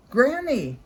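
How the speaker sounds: loud high call up the house; a girl hollering up the stairs. A narrator's reading. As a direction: call Granny's name from the door. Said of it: "Red call out".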